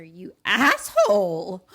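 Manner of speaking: loud, drawn-out hammed-up insult; mock furious yell. Gunman who just shot Garrett, mock fury. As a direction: yell the insult, nearly cracking up. Retold gunman curses his victim.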